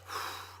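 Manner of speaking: soft unvoiced sigh of breath; demo sigh out. Shown as a demonstration. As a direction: let the air go in a sigh. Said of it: "And as you breathe out".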